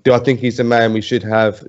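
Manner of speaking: ordinary talk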